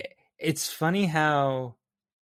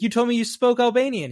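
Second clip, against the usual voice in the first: higher, louder imitated accusation; accusing; 10 semitones higher, 5 dB louder, faster than usual. Cop confronting the construction worker about Albanian, accusing. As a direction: confront him like a cop catching a lie.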